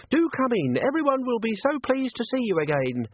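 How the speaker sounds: a bright, high welcoming voice; high cheerful young hostess. Acted. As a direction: beam at the guests. Colette welcoming visitors.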